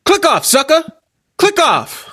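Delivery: high, mocking jeer; mock the viewers who click away; taunting. Taunting viewers who leave the podcast.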